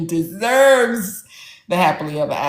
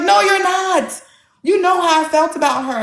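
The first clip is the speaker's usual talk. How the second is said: retold screaming; 10 semitones higher than usual. Upset brother scolding his author sister on the phone, high mock outrage. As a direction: imitate an upset brother protesting, pitched up. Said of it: "He called me screaming".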